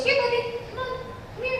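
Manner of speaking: high-pitched coaxing dog call; calling a puppy. Acted.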